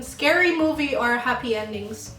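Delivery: ordinary talk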